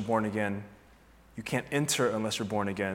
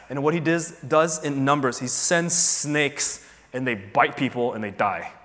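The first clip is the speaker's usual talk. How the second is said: normal talking voice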